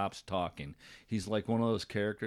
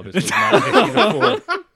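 laughing; 14 dB louder than usual. Real, not acted. Laughing denial.

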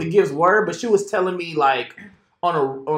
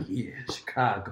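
low scheming voice; 8 semitones lower, 12 dB quieter than usual. Retold or imitated. Friends' scheming hype retold.

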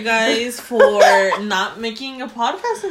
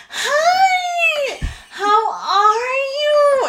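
very high, stretched-out mock gushing voice; fake sweet greeting; much slower than usual. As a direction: imitate an over-friendly squeal, dragging the vowels. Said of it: "Why is she talking like that?".

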